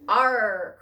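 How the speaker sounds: loud, long "Arr"; storybook crew shouting back; pirate crew's cry. A narrator's reading. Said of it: "cried the crew".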